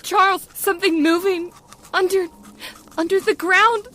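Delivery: frightened warning to her husband; alarmed. Acted. Wife sees creature under ground.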